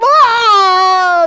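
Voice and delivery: long, high, very loud wail; hammed-up lost-kid scream; acted child's scream. Kid who lost track of mom at Walmart, screaming. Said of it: "I'm screaming".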